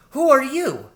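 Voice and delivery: put-on high, loud child's voice of alarm; startled girl rat. A narrator's reading. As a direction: demand it in a piping, alarmed young voice. Startled child rat confronts stranger.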